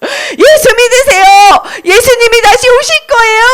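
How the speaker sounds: high, ringing proclamation; rousing street-preacher call; evangelist's cry. Acted. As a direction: announce it high to a whole crowd. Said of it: "이렇게 말했어".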